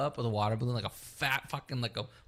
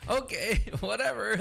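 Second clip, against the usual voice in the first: laughing it off; 8 semitones higher than usual. Retold or imitated. Shrugging off the guard's threat, laughing. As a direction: chuckle out an unbothered okay.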